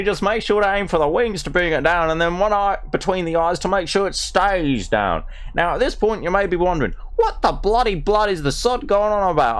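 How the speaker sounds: broad ocker Australian voice, played for laughs; reading a butterfly book as a brash Australian; mock Australian accent. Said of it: "we're going with the Darrow Australian".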